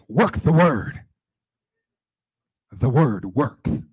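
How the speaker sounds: imitated loud, fervent proclamation; Margaret Washington. Colleague who just heard from God, imitated fervent shout. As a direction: proclaim it loud, stretching the last phrase. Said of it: "And she said".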